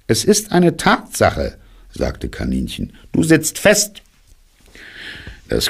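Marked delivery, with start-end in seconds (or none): 0.1-1.6 Kaninchenstimme. Acted. Kaninchen stellt sachlich fest. 3.1-4.0 Kaninchenstimme. Acted. Kaninchen stellt fest.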